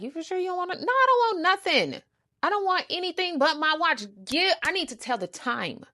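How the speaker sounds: high-pitched outraged insistence; high indignant. Retold or imitated. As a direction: squeal it out offended. Quarrel voiced in retelling.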